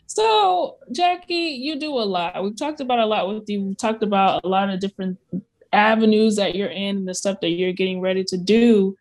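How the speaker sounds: usual speaking voice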